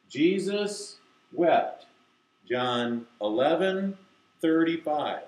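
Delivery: drawn-out recitation, each word held; slow, deliberate. Shown as a demonstration. Teacher reading the verse for kids to repeat, slow and deliberate. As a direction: read it slowly for children to mouth along. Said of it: "I have to say it slowly, deliberately".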